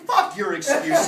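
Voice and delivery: high, loud angry outburst; angry snap. Acted. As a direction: bite his head off. Boss berates worker in scene.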